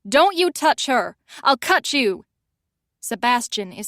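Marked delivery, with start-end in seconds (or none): 0.1-2.2 loud, slow, high battle yell. Acted. Callie charges in to protect Fiona, loud threatening yell. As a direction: charge in yelling with the rolling pin. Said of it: "yelling at the top of my lungs".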